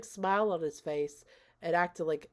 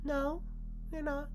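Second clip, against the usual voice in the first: high, soft, calm refusal, imitating a hostess; unruffled calm; 9 semitones higher, 5 dB quieter than usual. Hostess refusing to let the guest leave, calm and high. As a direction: say it sweetly composed, pitched high. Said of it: "Like, just so calm".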